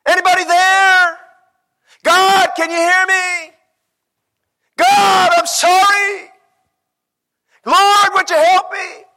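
high, desperate shouted plea; crying out loud. Acted. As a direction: wail to God with long-drawn words. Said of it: "He's crying out loud".

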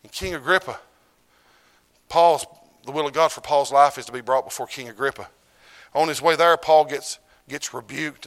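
loud, rising pulpit surge; loud preaching. Acted. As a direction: drive the story home. Preacher surges to full force.

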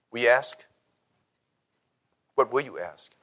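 ordinary talk, nothing marked

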